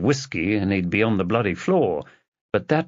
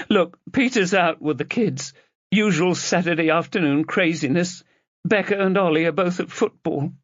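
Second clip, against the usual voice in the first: lightly voiced distraught sister, higher; a hint of the upset sister, pitched up; Rosie's voice; 9 semitones higher than usual. A narrator's reading.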